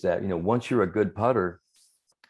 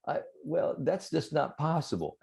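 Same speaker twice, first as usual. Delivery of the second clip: higher, softer mock disbelief; imitated bafflement; 7 semitones higher, 5 dB quieter than usual. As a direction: puzzle it out in a small, high voice. Acting out Johnny's baffled disbelief.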